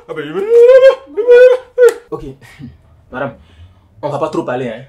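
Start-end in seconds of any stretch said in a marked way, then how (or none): 0.1-1.8 laughing. Acted. Mocking laugh at suspects.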